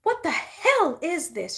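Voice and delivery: high, slow, loud angry demand; furious outburst. A narrator's reading. Elphaba confronting the sisters over the monkey, slow furious demand. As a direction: let the fury spill out slowly. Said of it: "she said".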